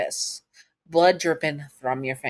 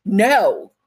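sharp refusal; 5 semitones higher, 6 dB louder than usual. Acted. Captive refuses captor angrily.

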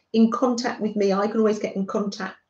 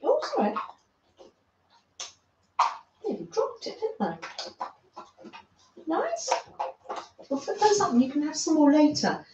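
high sing-song pet talk; 6 semitones higher, faster than usual. Real, not acted. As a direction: coo at the dog while feeding him. Handing a treat to dog Freddie.

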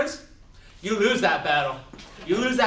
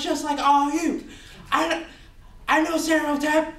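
higher, lightly put-on Chinese accent; 7 semitones higher than usual. Plays a Chinese stereotype figure.

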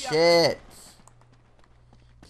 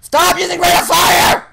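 furious yell, much higher and louder; angry shout; 11 semitones higher, 8 dB louder than usual. Real, not acted. Gamer raging at enemies using rate of fire, furious shout. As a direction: scream it in real rage at the other players. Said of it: "Hope you enjoyed my little rage".

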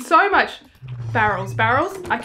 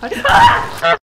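sudden high scream; scared horror game streamer; 12 semitones higher, 5 dB louder than usual. Real, not acted. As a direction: shriek of fright.

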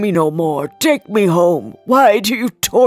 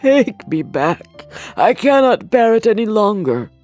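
broken voice; 4 semitones higher, 5 dB louder than usual. Acted. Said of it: "said Scrooge in a broken voice".

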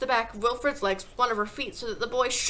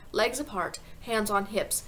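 usual speaking voice

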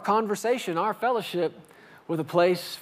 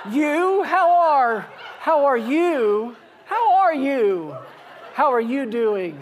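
overdone friendly greeting; 7 semitones higher, much slower than usual. Acted. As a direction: stall with hammed-up delight, stretching each word. Stalling with an unknown caller.

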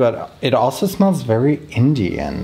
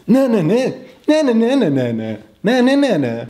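excited German dub voice; 11 semitones higher than usual. Retold or imitated. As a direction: rattle it off like an overexcited dubbed chef. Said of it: "His German dub voice".

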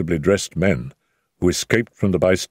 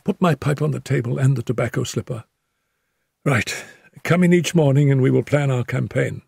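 higher, subdued voice of a wounded detective; injured Holmes; 8 semitones higher than usual. A narrator's reading. Wounded Holmes reassures Watson at his bedside, higher subdued voice. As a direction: speak up from the pillow, hurt but calm.

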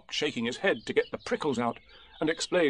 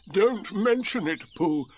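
slow, higher, long-suffering donkey voice; gloomy donkey; 8 semitones higher, 4 dB louder, slower than usual. A narrator's reading. Donkey brushing off the bear, slow and wounded. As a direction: draw it out with weary sarcasm.

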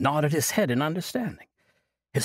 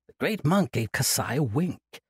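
ordinary delivery, nothing marked

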